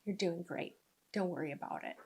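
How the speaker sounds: soft, low reassurance; hushed and comforting. Acted. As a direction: soothe quietly, as a kind inner voice. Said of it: "my higher self goes".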